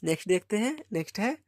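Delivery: ordinary delivery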